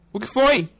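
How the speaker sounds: ordinary talk, nothing marked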